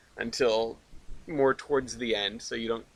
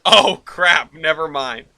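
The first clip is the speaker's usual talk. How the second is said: exclaiming; 3 semitones higher, 10 dB louder, slower than usual. Retold or imitated. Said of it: "And I went".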